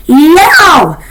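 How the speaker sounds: sharp high yelp of pain. A narrator's reading. Bitten by her sister, sharp pained yelp. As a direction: yelp like a wounded animal. Yelps after the bite.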